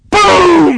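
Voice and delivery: loud, high shouted boom; imitated cannon boom. Storyteller imitating the cannon shot, loudly. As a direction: blast it out like a cannon firing.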